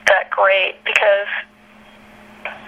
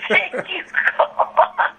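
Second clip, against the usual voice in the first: laughing thanks; 10 semitones higher, much slower than usual. Real, not acted. Laughing relief at being spared.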